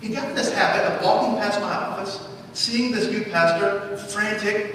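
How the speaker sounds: normal talking voice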